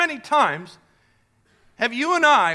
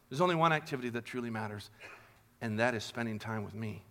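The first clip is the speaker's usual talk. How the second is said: soft, tender voice, much softer and lower; loving Jesus; 9 semitones lower, 8 dB quieter than usual. Pretend. Jesus speaking tenderly to worried Martha, soft and loving. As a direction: soothe her like a close friend. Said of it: "he lovingly responded".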